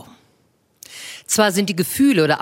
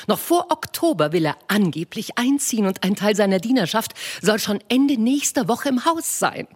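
Mrs Bennet voiced high and bright-eyed, fussing; a high, eager matron gushing to her husband; eager wife; 5 semitones higher than usual. Wife to her husband with news, eager high voice.

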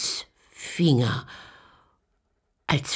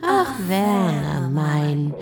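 louder, slow mock-tender witch; slow, louder witch crooning; 3 semitones higher, 6 dB louder, slower than usual. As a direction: draw the name out cruelly. Witch to Werner.

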